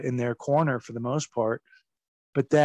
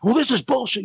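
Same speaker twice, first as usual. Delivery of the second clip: mock angry retort; 9 semitones higher, 5 dB louder than usual. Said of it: "very diplomatically, you know, I wasn't like".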